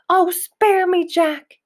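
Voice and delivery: high, loud begging giant; a giant pleads with Jack in a nightmare; pleading yell. A narrator's reading. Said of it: "he yelled".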